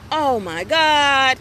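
slow, loud drawn-out oh my God; stretched-out embarrassed oh my God; mock embarrassed groan. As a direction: play the embarrassed teen nephew. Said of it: "like he was embarrassed".

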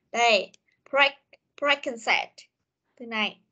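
normal talking voice

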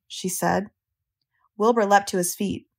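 usual speaking voice

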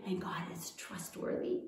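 breathy whispered closing line; soft whisper. Shown as a demonstration. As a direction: whisper it like a precious secret. Final line said as a secret.

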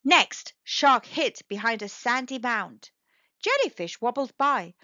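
usual speaking voice

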